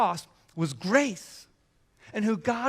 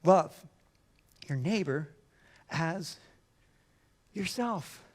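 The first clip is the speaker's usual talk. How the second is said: lower, with long pauses between words; solemn slow delivery; 4 semitones lower, 3 dB quieter than usual. Real, not acted. Preacher delivering the one command, slow and lowered. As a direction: lay each word down slowly.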